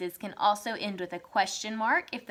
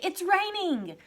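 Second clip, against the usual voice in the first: startled, raised-pitch outburst; shocked exclamation; 9 semitones higher, 3 dB louder, slower than usual. Shown as a demonstration. Teacher showing someone shocked by the rain outside, shocked. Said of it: "are shocked by, um, the rain".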